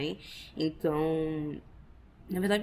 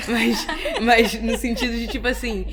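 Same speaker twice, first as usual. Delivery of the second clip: laughing; 3 semitones higher, 6 dB louder than usual. Real, not acted. Laughing after aside.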